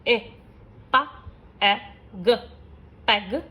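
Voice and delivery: slow phonics blending with pauses; sounding out hat. Shown as a demonstration. Teacher showing short vowel examples, sound by sound. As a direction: say ए, हा, ए, ट as separate clipped sounds, then blend them into हैट. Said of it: "short vowel के कुछ examples हैं".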